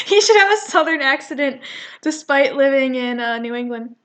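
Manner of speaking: laughing. Real, not acted. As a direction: laugh through the correction.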